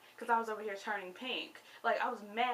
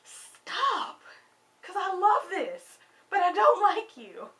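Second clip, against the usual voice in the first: higher and louder, begging it to stop; petty plea; 9 semitones higher, 10 dB louder, slower than usual. Retold or imitated. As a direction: torn fan, half pleading. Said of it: "And I'm like".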